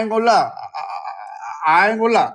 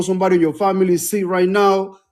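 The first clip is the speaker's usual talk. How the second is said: ordinary talk, nothing marked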